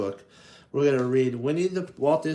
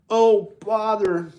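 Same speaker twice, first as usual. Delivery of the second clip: high, louder, slow dismayed sigh; Pooh's oh bother; 8 semitones higher, 8 dB louder, slower than usual. Acted. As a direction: groan it as he falls. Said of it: "Pooh falling".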